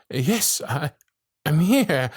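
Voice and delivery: normal talking voice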